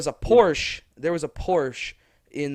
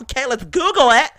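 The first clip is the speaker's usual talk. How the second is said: higher and louder, daring tone; mock challenge; 10 semitones higher, 8 dB louder than usual. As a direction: dare her to check. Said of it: "Like, like".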